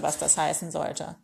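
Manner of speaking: usual speaking voice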